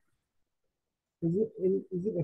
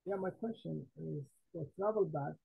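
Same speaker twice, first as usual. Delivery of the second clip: barely audible; 9 dB quieter than usual. Real, not acted. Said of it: "just a little bit better than it was before".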